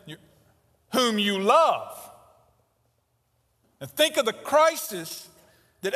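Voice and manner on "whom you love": "whom you love." drawn out very slowly; slow emphasis. Shown as a demonstration. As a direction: stretch "love" out, pained. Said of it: "second understatement".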